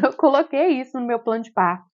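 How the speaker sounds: ordinary delivery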